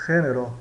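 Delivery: "Gênero" starting with a rasped H sound instead of zh; Spanish G as J. Shown as a demonstration. Teacher showing G before E, Spanish J sound. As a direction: open "Gênero" with the breathy friction of Spanish J. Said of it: "como o J espanhol".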